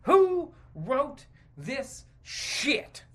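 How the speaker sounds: usual speaking voice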